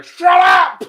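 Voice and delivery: loud, high-pitched shout; full-volume retold yell; yelling out the window. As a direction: bellow it at someone far off. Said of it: "leaning out the window going".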